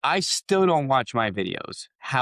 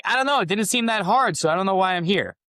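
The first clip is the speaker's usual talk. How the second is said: much higher, puzzled and a bit naive; clueless; 10 semitones higher, 4 dB louder than usual. Retold or imitated. Telling the mastermind group he didn't find it hard, much higher and clueless. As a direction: play it wide-eyed and baffled. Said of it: "And I was like".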